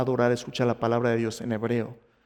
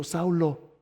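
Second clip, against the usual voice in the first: higher, insistent divine appeal; God pleading; 6 semitones higher than usual. Acted. God tells blind Saulo he has sought him, higher insistent appeal. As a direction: plead your case with the pitch raised. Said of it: "Dios le ha estado diciendo".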